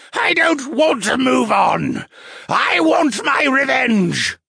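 high, loud, drawn-out monster scream; wraith's shriek. A narrator's reading. Wraith refuses to move on, slow vengeful shriek.

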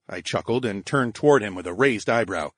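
plain reading voice

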